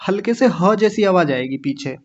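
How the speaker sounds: usual speaking voice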